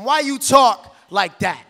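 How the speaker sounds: very loud, forceful poetic refrain; challenging shout. Acted. Poet challenging someone's way of talking, forceful shout. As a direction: throw the question out at full volume.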